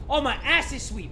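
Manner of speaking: ordinary talk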